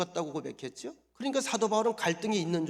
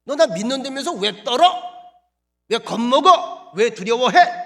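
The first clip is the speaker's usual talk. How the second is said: scolding; 6 semitones higher, 15 dB louder than usual. Pretend. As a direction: bark it like a stern rebuke. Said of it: "이렇게 야단쳐야 정상이거든요".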